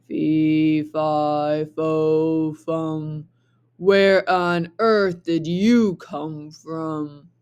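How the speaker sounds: loom over him and boom; booming giant. Acted. Giant looms over Jack and booms his question.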